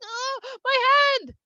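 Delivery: high, hammed-up wail; alarmed cry. Moshe seeing the rash on his hand, alarmed.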